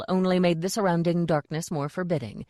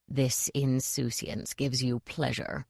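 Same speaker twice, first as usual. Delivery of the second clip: low, slow, menacing hiss; softer, slow hissing threat; 4 semitones lower, 6 dB quieter, slower than usual. A narrator's reading. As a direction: hiss it slowly through the teeth at the captive. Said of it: "she hissed".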